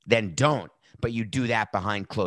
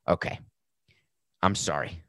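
normal talking voice

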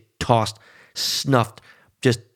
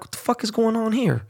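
disbelief; 11 semitones higher than usual. Retold or imitated. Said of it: "I'm like".

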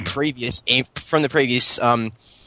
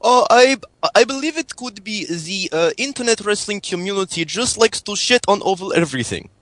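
higher-pitched mock Russian accent with broken English; fake Russian accent; 4 semitones higher than usual. Prank caller phoning hosts in fake Russian accent. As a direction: play a hammed-up Russian caller. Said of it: "was a good Russian accent".

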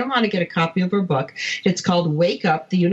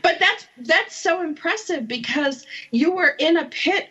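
laughing through the words, higher pitched; laughing apology; 7 semitones higher than usual. Real, not acted. Host laughing after misnaming her guest's country, laughing. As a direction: giggle out an embarrassed sorry and keep laughing.

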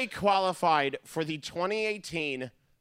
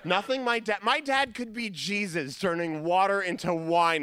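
ordinary talk, nothing marked

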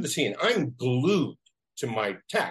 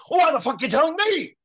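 high, loud mock outrage; exasperated outburst; 7 dB louder, faster than usual. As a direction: throw it up, incredulous and fed up. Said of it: "I didn't say it, but I wouldn't go".

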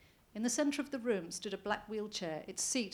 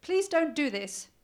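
high, loud, slow desperate plea; hysterical pleading; 7 semitones higher, 9 dB louder, slower than usual. Acted. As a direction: beg him with panic. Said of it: "a faint note of hysteria".

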